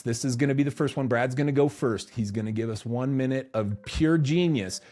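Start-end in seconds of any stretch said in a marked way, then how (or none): none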